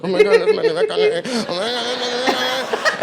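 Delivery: high, loud fanboy squeal with laughter; starstruck freakout. Retold or imitated. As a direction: gush like you just saw your idol. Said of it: "I'm like".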